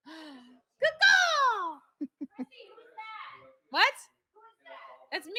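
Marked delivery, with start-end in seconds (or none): 0.1-1.8 high, loud cawing bird cry; loud crow caw. Pretend. Showing off a bird call to friends, loud high caw. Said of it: "It's my bird call".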